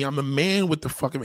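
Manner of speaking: usual speaking voice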